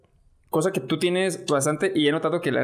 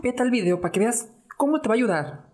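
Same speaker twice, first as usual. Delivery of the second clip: quoting a woman; 7 semitones higher than usual. Retold or imitated. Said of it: "fue una mujer".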